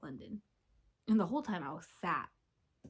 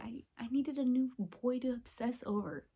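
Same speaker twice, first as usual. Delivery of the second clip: usual speaking voice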